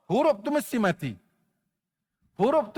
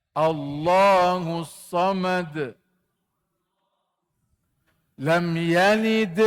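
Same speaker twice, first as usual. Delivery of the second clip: slow chanted tajwid recitation; Quran recitation; 3 dB louder, much slower than usual. Shown as a demonstration. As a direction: chant it slowly like a Quran teacher. Leads class Quran recitation. (bacaan Quran berlagu perlahan)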